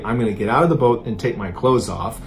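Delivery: plain reading voice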